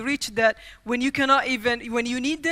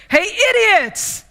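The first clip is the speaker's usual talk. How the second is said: high, slow, loud challenge; a kid's bold battle cry; mock heroic shout; 9 semitones higher, 4 dB louder, slower than usual. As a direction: call them out like a would-be superhero. Kid confronting bullies in costume.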